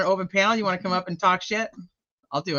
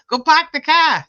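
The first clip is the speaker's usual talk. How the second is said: "go park the car" with Boston dropped r, "pahk the cah", pitched higher and louder; Boston accent; 11 semitones higher, 7 dB louder than usual. Shown as a demonstration. Showing how her son says it in a Boston accent. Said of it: "that's how you'd say it".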